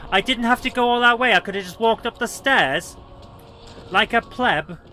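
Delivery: high, loud exclamation of disbelief; loud exasperation. Real, not acted. Gamer realising the detour was needless, loud exasperation. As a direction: exclaim it loudly at your own blunder.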